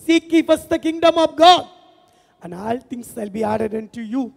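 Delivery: very slow, loud, weighty English verse; slow emphatic quote. Shown as a demonstration. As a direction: lay each word down slowly. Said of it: "முதலாவது". (மெதுவாக அழுத்தமாக)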